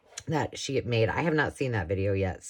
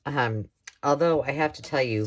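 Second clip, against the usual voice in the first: ordinary talk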